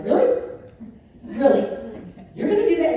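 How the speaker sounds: high, loud, incredulous challenge; retold going off. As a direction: replay an outraged confrontation, voice jumping up. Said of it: "just completely went off on her".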